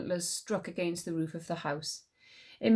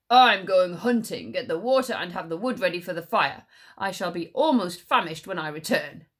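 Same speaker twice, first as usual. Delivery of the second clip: bossy tiger; 6 dB louder than usual. Acted. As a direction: order the stag around. Tiger voice in story.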